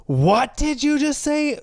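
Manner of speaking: high-pitched, drawn-out mock yell; retold scream. Man confronting two friends as they walk away, mock scream. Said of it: "Screams full volume".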